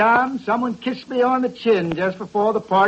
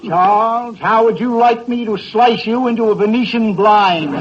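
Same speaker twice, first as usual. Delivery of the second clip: ordinary talk, nothing marked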